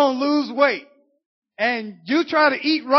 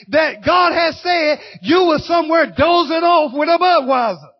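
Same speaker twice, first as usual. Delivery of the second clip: preaching at full force; 3 semitones higher, 4 dB louder than usual. Acted. Preacher calls out the churchgoers who quit, loud and driving.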